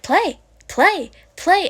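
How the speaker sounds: an excited puppy begging to play; eager puppy voice. Retold or imitated. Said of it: "They're like".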